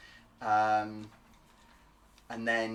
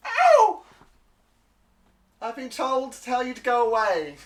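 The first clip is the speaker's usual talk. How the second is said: shaking voice; 12 semitones higher, 10 dB louder than usual. Acted. Hurt kobold relaying the order to leave: high frightened voice. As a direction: yelp then high, louder frightened kobold. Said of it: "you kinda hear his voice shaking".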